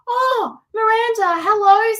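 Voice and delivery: high, loud burst of delight; excited greeting. Real, not acted. Host greets her daughter Miranda joining the live, thrilled. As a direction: squeal a hello to someone you adore. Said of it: "I got a bit excited there".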